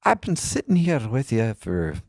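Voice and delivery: high, lilting put-on Irish brogue of an old man; lightly hammed-up Irish accent, pitched well up; mock Irish accent. Old Irish fella giving feedback, bad Irish accent. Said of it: "I'm not doing a very good, uh, Irish accent".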